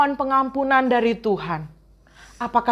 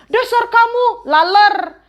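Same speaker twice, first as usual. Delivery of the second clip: high mocking insults; higher, teasing name-calling; name-calling taunt; 9 semitones higher, 3 dB louder than usual. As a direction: jeer like a rude child.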